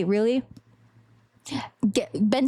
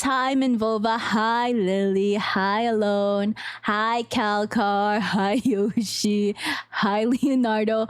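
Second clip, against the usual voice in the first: slow, low greetings; pitched down and unhurried; deepest voice; 4 semitones lower than usual. Shown as a demonstration. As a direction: draw out each greeting in a deep voice. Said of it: "this is my deepest voice".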